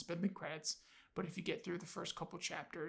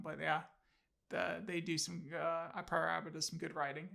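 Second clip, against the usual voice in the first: usual speaking voice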